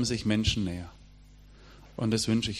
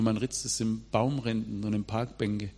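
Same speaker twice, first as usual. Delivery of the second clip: normal talking voice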